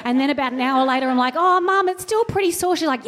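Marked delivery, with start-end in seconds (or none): 1.3-2.8 put-on high, plaintive child voice; a little girl complaining; whiny kid. Girl telling mum her arm still hurts, high and whiny.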